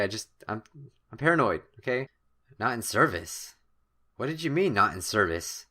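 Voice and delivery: ordinary talk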